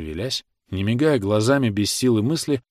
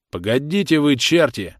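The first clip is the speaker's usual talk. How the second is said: raised, louder exasperated cry; shouted protest; 5 semitones higher, 4 dB louder than usual. A narrator's reading. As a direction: yell at them to hold off. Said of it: "кричали им". (возмущённый окрик)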